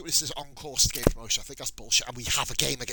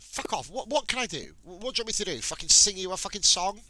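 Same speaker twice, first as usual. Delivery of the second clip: higher, fed-up outburst at the player; exasperated rant; 8 semitones higher than usual. Real, not acted. Fed up with his moaning squad player, a higher exasperated rant. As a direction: vent at a player who won't stop moaning.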